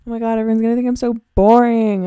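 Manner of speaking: playful anxious rant; imitating my past worry; mock panic. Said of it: "I was like".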